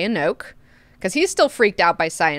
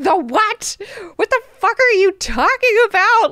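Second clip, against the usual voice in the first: higher, louder baffled outburst; mock angry Duncan; 12 semitones higher, 5 dB louder than usual. Duncan snapping at Leto, higher and louder in disbelief. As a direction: blurt it out in disbelief. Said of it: "Duncan's like".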